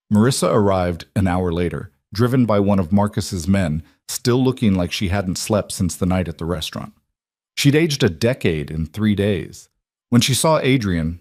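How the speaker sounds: usual speaking voice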